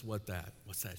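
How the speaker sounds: soft, low murmured asking; Rocky's quiet question; hushed question. Retold or imitated. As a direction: keep it close and small. Rocky asks, hushed retelling.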